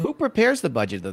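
usual speaking voice

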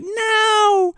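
high-pitched drawn-out cry of protest; mock desperate cry. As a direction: imitate a movie heroine's long, high wail. Retold girl's cry to stop.

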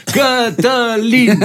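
very high, long drawn-out name; mock stage call. Mayor onstage drowns out the band's name, imitated drawn-out call. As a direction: stretch it out over the chanting crowd, in imitation. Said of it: "el de pe scenă".

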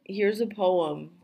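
ordinary talk, nothing marked

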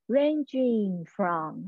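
ranging from stretched slowly and wide; teacher stretching ranging from. Shown as a demonstration. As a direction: draw out ranging from like a wide shot. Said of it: "很长镜头的".

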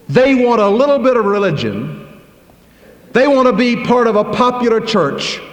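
a preacher's rising fiery rebuke; full-force preaching. Acted. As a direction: hammer the point, building fire. Preaching hard against softies.

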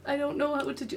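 nervous fan fumbling for words, barely audible; flustered mumble. Retold or imitated. Starstruck fan stammering to a voice actor, soft and flustered. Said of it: "I talked like that".

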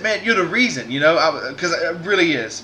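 usual speaking voice

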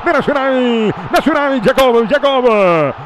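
grito de gol creciente; breakneck goal commentary. Real, not acted. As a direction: sube la euforia hasta el gol. Gol de Nacional. (relato de gol eufórico)

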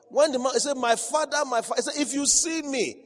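high, loud preaching; high fervent sermon voice. Acted. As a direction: proclaim the story to the church, loud. Preaching at full force.

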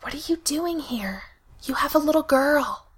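urgent hushed whisper; whisper yelling. A narrator's reading. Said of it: "he hissed, almost inaudibly".

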